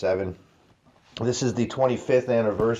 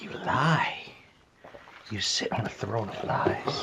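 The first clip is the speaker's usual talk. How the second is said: whispered mock accusation, slowing down; 5 dB quieter than usual. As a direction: hiss the accusation in a whisper, then slow. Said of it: "I was like".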